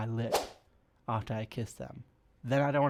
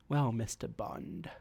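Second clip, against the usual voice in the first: sultry villainess. Retold or imitated. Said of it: "villainous vixen of a woman".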